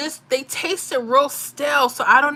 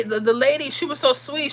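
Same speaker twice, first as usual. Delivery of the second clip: normal talking voice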